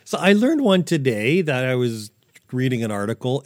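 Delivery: ordinary talk, nothing marked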